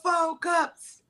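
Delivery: high mock-activist voice for the culture. Mocking activists to friends, high preachy voice.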